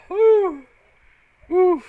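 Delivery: high, loud whew catching breath after laughing; breathless whew. Real, not acted. Streamer recovering from a laughing fit, breathless whew. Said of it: "that one's got my eyes watering".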